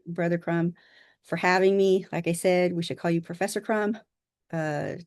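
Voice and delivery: ordinary delivery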